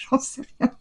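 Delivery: real amused laugh through the words. Real, not acted. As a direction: laugh while admitting you have no idea. Laughs at own confused guess.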